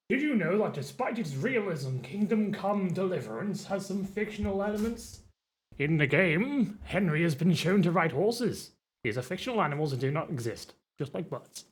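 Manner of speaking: hushed nature documentary narration; narrator voice. Pretend. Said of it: "narrator voice".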